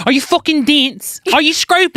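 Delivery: high, indignant mock Cockney; mock-fight in high-pitched Cockney; exaggerated Cockney insult; Cockney accent. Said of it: "can we do it in that accent".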